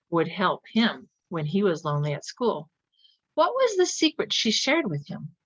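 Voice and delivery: ordinary delivery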